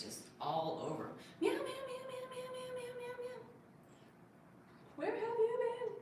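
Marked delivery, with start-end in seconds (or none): 1.4-3.4 meowing. Pretend. Talking to cat, imitating meows. 5.0-5.9 very high cooing voice for the cat; baby voice to cat. Pretend.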